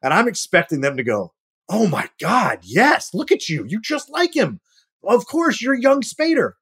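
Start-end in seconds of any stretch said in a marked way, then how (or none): 1.7-6.5 high, excited gushing over the lookalike. Pretend. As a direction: gush like a thrilled casting team. Imagined casting people gushing.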